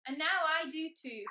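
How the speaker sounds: merry. A narrator's reading. Mister Tickle after tickling the dragon, loud and merry. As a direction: say it brightly, as if amused, without real laughing. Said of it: "he laughed".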